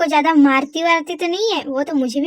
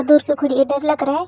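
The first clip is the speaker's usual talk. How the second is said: ordinary talk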